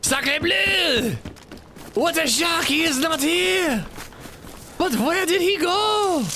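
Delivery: high-pitched, drawn-out mock French accent. Gamer finding he is not here, hammed-up French accent. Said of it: "doing that French accent".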